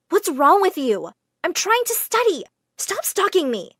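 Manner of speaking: high-pitched, fed-up protest; exasperated. Acted. Girl to the boy chasing her round school, exasperated. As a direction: tell off someone who keeps following you.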